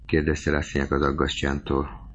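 ordinary delivery

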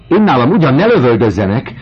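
loud, high, fast protest; indignant protest. A narrator's reading. The landlady protesting against shooting in her house. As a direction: fire off the objection at once. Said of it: "tiltakozott Vokéné".

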